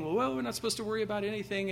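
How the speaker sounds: high, fast mocking voice; lightly mocking a careless attitude; mock careless quote. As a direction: toss it off like a naive believer. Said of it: "it's not this kind of thing".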